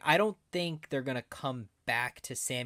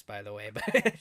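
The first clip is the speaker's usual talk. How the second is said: ordinary delivery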